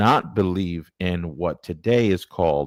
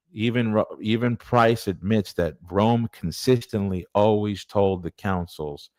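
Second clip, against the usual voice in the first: normal talking voice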